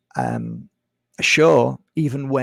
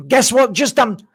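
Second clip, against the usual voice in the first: high, louder, hammed-up gossip; exaggerated excitement, voice pitched up; mock excited news; 10 semitones higher, 5 dB louder than usual. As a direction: imitate someone dying to share news. Acts out someone bringing big news.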